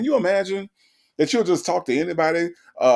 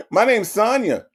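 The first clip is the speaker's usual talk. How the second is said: flirty mock Sonya; 6 semitones higher than usual. Host imitating Sonya teasing the scammer, high and flirty. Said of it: "That's what that would be".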